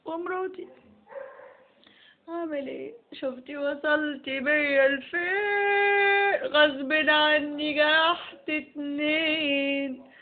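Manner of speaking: slow, hammed-up self-pity; melodramatic lament. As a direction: drag each word out like a soap hero. Husband laments hurting two women.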